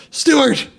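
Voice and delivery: high, loud imitation of a fed-up brother; mock exasperation. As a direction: scold your little brother in a retold outburst. Brother corrects confused Stuart, retold.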